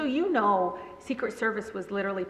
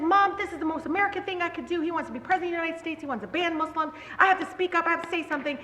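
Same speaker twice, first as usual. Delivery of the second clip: high, insistent protest to mom; retold earnest, raised-pitch self-defence; pleading daughter; 8 semitones higher, faster than usual. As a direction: argue your case in a rush. Retold pleading argument with mother.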